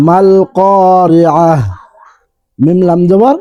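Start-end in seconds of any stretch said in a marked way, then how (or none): 0.1-1.6 "আল কারিআহ" ending in a stopped h, slow; pausal form. Shown as a demonstration. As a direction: recite "আল কারিআহ" stopping on the final h. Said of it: "উচ্চারণ হবে এইভাবে". ("আল কারিআহ" থেমে হ দিয়ে শেষ)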